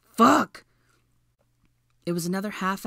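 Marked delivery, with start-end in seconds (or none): 0.0-0.6 high, loud curse; desperate yell. A narrator's reading. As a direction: shout it out in desperation. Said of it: "Draco yelled desperately".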